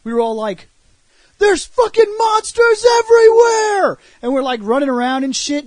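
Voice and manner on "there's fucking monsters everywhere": high, slow panicked yell; hammed-up panicked cry; mock panic shout. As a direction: scream it like monsters are everywhere. Panicked group in retold sketch.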